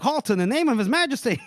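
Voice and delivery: high, loud commanding halt; mock guard bellowing halt; guard's shout. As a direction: bark the order like a city guard.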